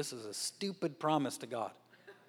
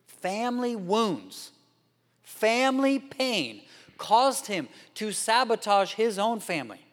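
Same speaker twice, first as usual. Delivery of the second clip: slow, louder, much higher preaching; preaching at full force; 6 semitones higher, 7 dB louder than usual. Real, not acted. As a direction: drive the point home slowly. Preacher's climax.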